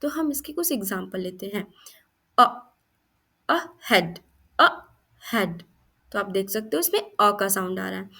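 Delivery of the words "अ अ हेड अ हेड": short punchy अ, then हेड apart; sounding out अ. Shown as a demonstration. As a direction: split अ off from हेड. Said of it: "पहला phonic sound अ का है".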